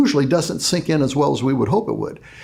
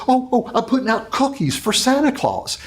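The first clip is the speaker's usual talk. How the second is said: put-on much higher, excited small child's voice; eager little boy; 10 semitones higher than usual. Billy explaining to his brother, eager high child voice. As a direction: sound four and thrilled about Santa. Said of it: "And Billy says".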